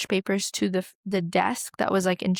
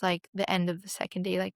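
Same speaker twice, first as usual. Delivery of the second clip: ordinary talk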